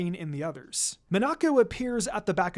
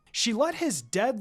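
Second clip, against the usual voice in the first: usual speaking voice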